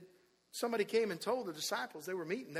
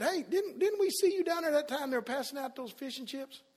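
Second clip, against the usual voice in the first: lightly voiced, higher-pitched accuser; questioning bystander; 7 semitones higher, 4 dB louder than usual. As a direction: quiz him like a nosy onlooker. Another accuser quoted in the story.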